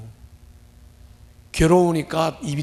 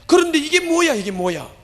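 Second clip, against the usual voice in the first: higher, louder exasperated outburst; a pastor's shocked exclamation; dismayed cry; 10 semitones higher, 4 dB louder than usual. Retold or imitated. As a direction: throw up your hands in dismay. Voicing Paul's dismay.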